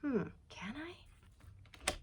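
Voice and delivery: slow doubtful whisper; a slow, wondering whisper to herself. Retold or imitated. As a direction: breathe it out slowly, unsure. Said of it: "She's like".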